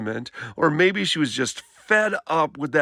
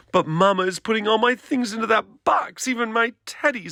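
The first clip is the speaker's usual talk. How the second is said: little sister; 9 semitones higher than usual. Acted. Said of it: "said Sister".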